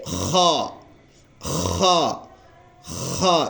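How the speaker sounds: "خا" with a scraped kh from the throat; rasped throat letter. Shown as a demonstration. Teacher naming the new letter, with throat friction. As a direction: rub and squeeze the kh of "خا". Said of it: "رگڑ کر کے یا نچوڑ کر کے".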